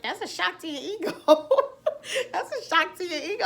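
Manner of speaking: laughing through the words. Real, not acted. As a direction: chuckle it out, amused at herself. Laughing at her own story.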